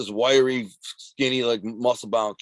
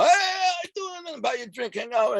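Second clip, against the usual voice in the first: mock fawning bully. Former bullies sucking up to the now-tough kid, in a mocking high fawning voice. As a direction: parody the ex-bully buttering him up.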